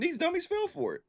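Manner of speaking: jumps way up into a high mocking squeal; a squeaky scornful jab at the dummies. Ranting at coworkers, high mocking squeal. Retold rant at drivers.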